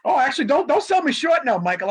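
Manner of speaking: mock outrage at being sold short; playful protest. Real, not acted. Protests being undersold, joking.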